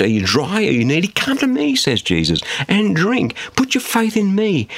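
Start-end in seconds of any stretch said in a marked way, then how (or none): 1.1-1.8 high, urgent invitation; impassioned, high-pitched quoted cry; quoted loud proclamation. Retold or imitated. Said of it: "said in a loud voice". 2.7-4.7 high, pressing preacher's appeal; impassioned, high-pitched quoted cry; quoted loud proclamation. Retold or imitated. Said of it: "said in a loud voice".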